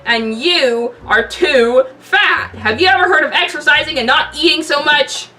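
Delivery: loud slow drawn insult; loud mocking put-on voice; taunting loud voice. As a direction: jeer it slowly and loud. Tony insults Bruce.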